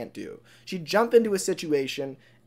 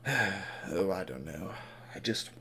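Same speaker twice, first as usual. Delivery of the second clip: very soft, low, defeated mumble; downcast; 8 semitones lower, 15 dB quieter, slower than usual. Acted. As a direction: sigh it out, hardly voiced. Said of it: "looks at the ground".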